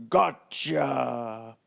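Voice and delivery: a touch of a giant grabbing his prey; giant crying out. A narrator's reading. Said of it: "the giant cried".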